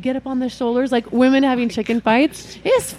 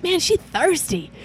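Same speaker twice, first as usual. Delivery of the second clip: imitating a guy's comment; 7 semitones higher, 5 dB quieter, slower than usual.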